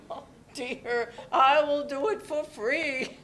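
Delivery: warm chuckle through the words; laughing. Acted. Nora answers Kat's therapist joke, laughing. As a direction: laugh as you make the offer.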